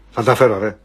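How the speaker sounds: very low voice promising quickly; deep sheepish voice, rushed. Acted. Takis rushing out a promise to pay in a deep put-on voice. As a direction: mumble the promise deep and fast.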